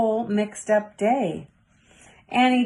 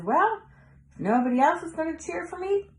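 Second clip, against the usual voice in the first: put-on much higher, quick child's voice; a girl deciding to cheer herself up; determined girl; 8 semitones higher than usual. A narrator's reading. Girl resolving to cheer for herself, high and quick.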